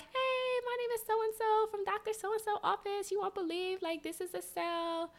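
high, chirpy office-lady voice; bubbly receptionist. Retold or imitated. Imitating the clinic lady on the phone, high and chirpy. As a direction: play a cheerful clinic receptionist. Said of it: "it's this lady like".

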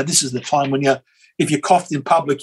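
ordinary talk

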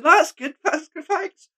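high-pitched, giggly mock fluster; giddy starstruck. Fan retelling replies to a voice actor hero, giddy. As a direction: squeak out thanks like a starstruck fan.

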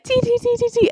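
high, airy, light chatter; squeaky airhead chatter. Retold or imitated. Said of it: "talking in our little airy voices".

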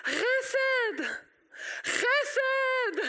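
high drawn-out yell for help; crying out. Acted. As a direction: shout it like a beggar calling across a road. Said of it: "begynner han å rope".

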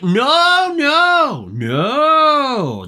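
loud, very high drawn-out refusals; mock-outraged denial. Real, not acted. Podcaster answering own rhetorical question about sticking to rules, mock-outraged. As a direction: pile up exaggerated no's, stepping down in pitch.

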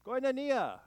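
Koinonia called out high and loud. Shown as a demonstration. Preacher calling the word out for the congregation, loud and high. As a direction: lead the room in Koinonia, loud. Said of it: "Let's all say it together".

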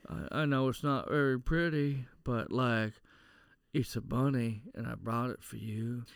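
low, halting film-scene imitation; understated mock-earnest, trailing down softly; mock movie-hero delivery. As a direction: lightly play an awkward hero offering a gift. Continues acted Con Air bunny scene.

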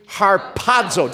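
"Harpazo" slowly, punching the PA; har-PA-zo, a slow led chant of "Harpazo"; accent on second syllable. Shown as a demonstration. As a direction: draw out "Harpazo" and hit the middle syllable. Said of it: "Accent on the second syllable".

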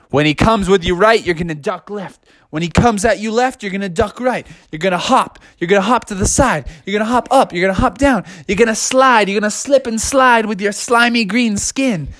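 high, building pep talk; urgent high-pitched cornerman drill; fired-up coach. Acted. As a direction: rattle off the fight plan, rising. Bucky drills the fight plan.

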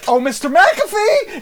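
high, loud, drawn-out mock call; imitating an actor's oblivious, sing-out entrance; imitated stage entrance. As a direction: burst in calling out, hammed-up. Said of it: "All of a sudden, I just hear".

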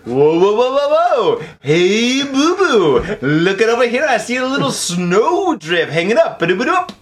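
sing-song, hammed-up cartoon bear with scat flourishes; big bouncy bear impression; Yogi Bear. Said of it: "fucking Yogi Bear. He's like".

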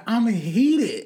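drawn-out, indignant declaration; mock outrage. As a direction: stretch it out in half-serious outrage. Acting out her dream outrage.

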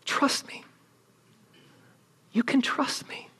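whispered trust me. Acted. Preacher whispering God's assurance to the congregation. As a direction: breathe the reassurance. Preacher voicing God softly.

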